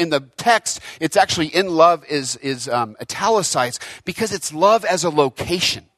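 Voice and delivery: normal talking voice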